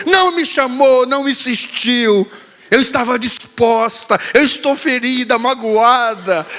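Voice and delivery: daughter's theatrical hurt, drawn out; mock-hurt daughter. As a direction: play the offended little girl.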